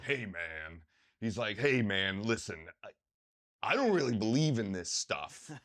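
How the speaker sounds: lightly voiced gruff tough guy; a hint of a tattooed bear of a man; Mark's gruff voice. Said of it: "he talks like this".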